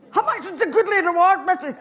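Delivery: put-on high cheeky kid bargaining; a chancer of a wee boy, pitched high.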